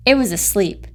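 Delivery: plain reading voice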